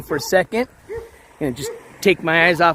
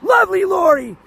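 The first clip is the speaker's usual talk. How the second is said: donation yell; 11 semitones higher than usual. Real, not acted. Streamer yelling thanks for a ten dollar super chat. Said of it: "I have to reserve my yelling".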